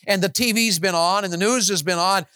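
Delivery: ordinary talk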